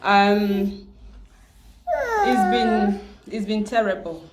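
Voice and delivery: fighting back real tears mid-sentence; breaking into tears. Real, not acted. Vlogger grieving her cousin, voice cracking with tears. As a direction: voice catching on a sob, then trailing off.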